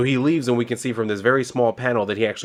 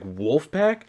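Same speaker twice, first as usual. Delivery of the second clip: higher, questioning rise; surprised recognition; 5 semitones higher than usual. Retold or imitated. Hero seeing an old enemy come up, surprised recognition. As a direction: ask it in mock surprise.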